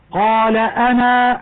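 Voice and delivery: أنا ended with a long alif when stopping; slow recitation stopping on أنا. Shown as a demonstration. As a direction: say قال أنا, stretching the final alif of أنا. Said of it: "عند الوقف".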